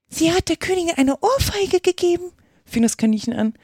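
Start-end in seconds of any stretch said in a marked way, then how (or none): 0.1-2.3 very high-pitched rabbit voice; gossiping rabbit. A narrator's reading. Kaninchen tuschelt aufgeregt. (Kaninchen mit sehr hoher Stimme)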